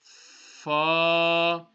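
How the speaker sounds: Fa with top teeth on the bottom lip; Arabic lip letter Fa. Shown as a demonstration. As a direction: bite the lip lightly for Fa. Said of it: "top teeth will touch the bottom lips".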